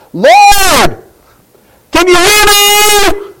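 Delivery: high, loud desperate plea. Retold or imitated. Blind beggar crying out to Jesus, loud and high. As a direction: call out to the Lord with all you have. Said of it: "he cried out even louder".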